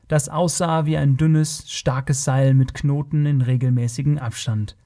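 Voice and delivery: ordinary delivery, nothing marked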